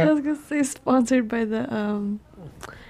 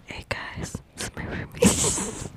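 soft, hushed mock ASMR aside; secretive podcast whisper; 2 semitones lower, 9 dB quieter, slower than usual. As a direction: whisper it to the microphone. Hushed for arguing roommates, ASMR.

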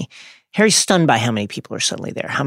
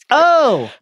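happy; 8 semitones higher, slower than usual. A narrator's reading. Luna happily agrees to take Harry, high and delighted. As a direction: burst out gladly in a high character voice. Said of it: "said Luna happily".

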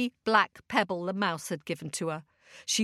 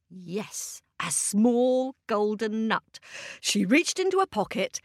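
ordinary delivery

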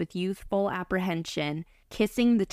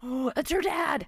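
surprised shout; 8 semitones higher than usual. Real, not acted. Cohost reacting to the father's arrival, surprised shout. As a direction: blurt out the twist in surprise.

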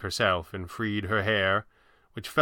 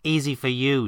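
higher, protesting heroine; mildly indignant, pitched up; 4 semitones higher than usual. A narrator's reading. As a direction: object as the put-out sidekick. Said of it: "she protested".

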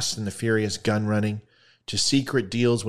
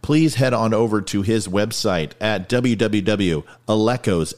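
louder, projected plug read; promotional sign-off; 6 dB louder than usual. Real, not acted. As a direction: deliver the show's closing plugs. Sign-off plugs over music.

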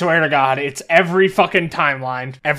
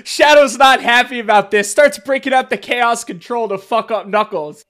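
ordinary talk, nothing marked

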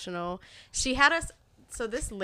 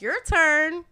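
retold call; 9 semitones higher, 10 dB louder than usual. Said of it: "they're like".

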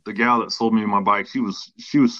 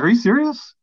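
much higher, louder "Are you serious?"; shocked disbelief; 13 semitones higher, 4 dB louder than usual. Retold or imitated. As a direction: shoot up in pitch, dismayed. Hears bike lacks traction control.

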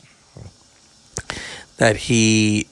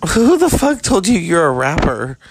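higher, louder, jeering at a wannabe rapper; mock outraged yell; 5 semitones higher, 4 dB louder than usual. Real, not acted. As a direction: fire it off like a heckler at a bad rap video. Mocking shout at Madonna.

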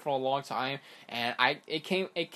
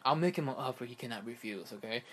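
softer, low, hushed mob boss voice; quiet mafia don menace, lightly done; Godfather impression; 5 semitones lower, 6 dB quieter than usual. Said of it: "bad, uh, Godfather impression".

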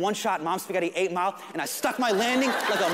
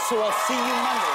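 calling out; 2 semitones higher, 3 dB louder than usual. Retold or imitated. Jon calling after him.

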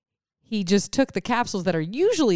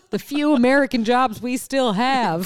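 loud, high comic rant; mock-indignant shout; mock outrage; 7 semitones higher, 5 dB louder than usual. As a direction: protest like an indignant patriot, hammed up. Mock rant about burnt factories.

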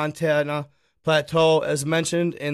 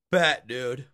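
ordinary talk, nothing marked

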